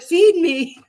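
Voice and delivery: whiny "feed me" from a hungry pet; demanding pet. Retold or imitated. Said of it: "They're like".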